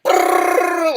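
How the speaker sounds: high rolled brrr trill; excited kitten trill. Retold or imitated. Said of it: "The little cat's like".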